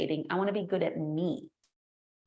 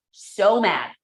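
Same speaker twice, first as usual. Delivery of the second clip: mock fury; 10 dB louder than usual. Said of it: "I'm like".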